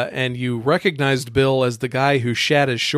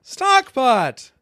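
loud announcement; 10 semitones higher, 3 dB louder than usual. Acted. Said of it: "am I supposed to say it with you?".

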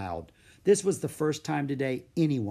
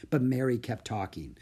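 usual speaking voice

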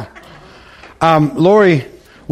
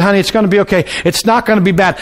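ordinary delivery

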